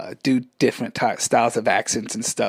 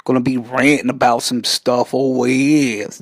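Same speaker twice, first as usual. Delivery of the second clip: ordinary delivery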